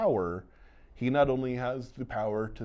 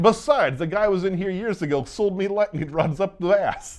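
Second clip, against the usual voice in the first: much higher and louder, cracking up through a smug brag; laughing mock boast; 9 semitones higher, 6 dB louder than usual. Owner smugly brushing off lightning warnings, laughing mock boast. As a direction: ham up the owner's smugness while barely holding a laugh.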